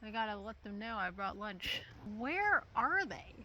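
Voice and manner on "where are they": slow, high sing-song call; coaxing a wild bird. Real, not acted. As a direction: gently call the birds in. Calling birds to lunch.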